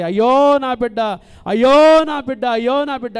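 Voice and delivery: put-on loud anguished cry for her child; retold mother wailing for her lost child; mother's wail. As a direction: cry out in horror for the baby. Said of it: "ఇక పెద్ద కేకలేస్తుంది".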